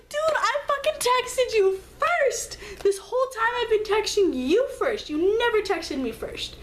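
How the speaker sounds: high, loud, indignant complaint; high outraged protest; outraged retort. Retold or imitated. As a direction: throw it back at him, fuming. Quoting her angry reply.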